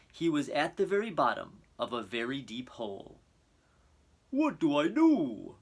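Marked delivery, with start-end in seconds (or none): 4.3-5.6 slow, louder worried cry; a lost rhino asking for help, anxious. A narrator's reading. Rhino at the bottom of a hole, worried and calling out.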